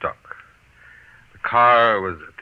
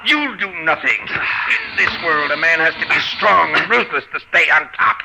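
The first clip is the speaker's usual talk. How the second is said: straining higher, louder threat; ruthless menace; 8 semitones higher, 4 dB louder than usual. Acted. Harvey straining to push Arkwright over, menacing. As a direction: crush him with each word. Killer pushing victim over cliff.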